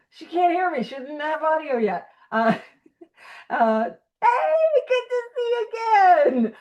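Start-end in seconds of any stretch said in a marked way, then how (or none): 3.8-6.5 high, loud, drawn-out hey; excited greeting. Real, not acted. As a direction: greet a friend with real, squealing delight. Greets someone joining the online meeting.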